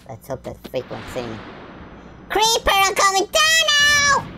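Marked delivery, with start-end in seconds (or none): 2.3-4.3 very high, loud yell; yelled warning. Pretend. Gamer warning the creeper, high-pitched yell. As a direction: holler it at the monster below. Calls out to game monster.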